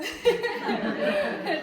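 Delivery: delighted open laugh; real laugh. Real, not acted. Laughs at child's gasp.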